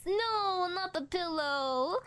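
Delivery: high, slow wail of mock grief; mock despair. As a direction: cry out over the lost pillow. Mock grief over pillow.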